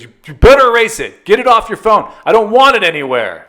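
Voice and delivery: mock-angry dad laying down the law; imitating stern dad. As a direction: demand it like an alarmed father. Dad's repeated demand acted out.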